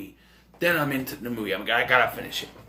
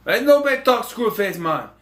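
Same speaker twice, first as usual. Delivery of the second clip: thug voice; 6 semitones higher, 5 dB louder than usual. Retold or imitated. Gang member answers Hatcher.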